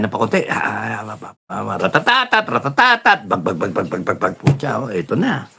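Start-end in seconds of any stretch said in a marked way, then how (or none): 1.8-3.3 higher rattling ratatatat of a machine gun; mouth gunfire. Retold or imitated. Imitating gunfire. 3.3-4.3 quieter, low bag bag shots; soft gunshot sounds. Retold or imitated. Imitating gunshots.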